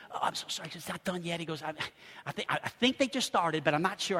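very soft, halting excuse; hushed, hesitant stammer; sheepish apology. Retold or imitated. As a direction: imitate an embarrassed clerk mumbling an update. Flustered employee apologizing for delay.

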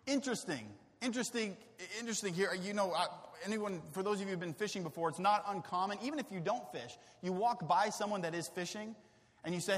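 ordinary talk

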